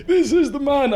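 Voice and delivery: put-on high-pitched accusing old man. Old man pointing out a suspect to the arriving officer, high-pitched accusation. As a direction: raise the pitch, an indignant old bystander. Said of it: "The old man goes".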